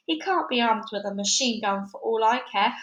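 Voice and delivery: ordinary delivery, nothing marked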